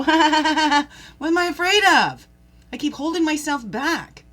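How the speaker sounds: loud, high laugh at your own fears; laughing at yourself. Shown as a demonstration. Showing listeners how to laugh at their fears, loud laughing. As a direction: laugh it off, big and bright. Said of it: "Can you laugh at yourself and go".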